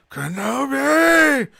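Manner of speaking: long drawn-out, high, loud yell; Maul's obsessed cry. Acted. Host acting Maul charging at his rival, yelling. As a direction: stretch the name into a mad battle cry. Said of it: "Maul just goes".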